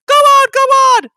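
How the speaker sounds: very high, loud silly squealing; giggling trucks. A narrator's reading. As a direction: taunt like giggling naughty trucks. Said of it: "they giggled in their silly way".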